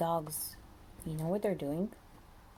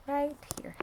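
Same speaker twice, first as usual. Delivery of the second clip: whisper; 7 semitones higher than usual. Real, not acted. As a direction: lean in and whisper it. Pointing out rock to child.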